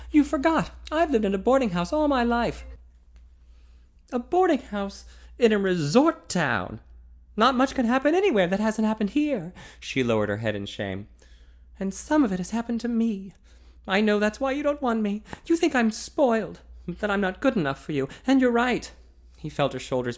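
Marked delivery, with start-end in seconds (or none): 0.1-9.5 put-on higher, louder voice of a young woman; heroine's voice. A narrator's reading. Heroine pleading with the man she loves, higher and louder. 11.8-18.9 put-on higher voice of a young woman, near tears; young woman in shame. A narrator's reading. Heroine confessing her shame to him, higher voiced.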